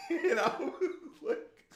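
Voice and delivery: real laugh breaking through high-pitched words. Real, not acted. Dad cracking up at his daughter's remark, laughing. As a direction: barely get the words out for laughing.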